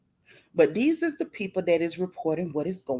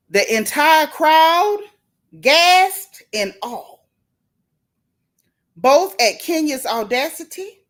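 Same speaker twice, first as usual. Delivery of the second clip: dramatic; 3 semitones higher, 5 dB louder than usual. Pretend. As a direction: build the scandal theatrically. Dramatic reading of gossip.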